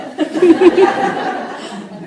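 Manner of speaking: loud high unvoiced burst of laughter; big laugh. Real, not acted. Pastor bursts out laughing about his daughter's singing. Laughing while telling family story.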